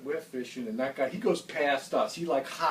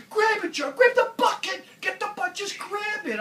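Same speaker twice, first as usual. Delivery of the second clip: high, loud frantic order; urgent shout; 8 dB louder than usual. Retold or imitated. Jerry with a fish flopping, frantically yelling at John. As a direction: yell at him to grab the flopping fish. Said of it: "He's like".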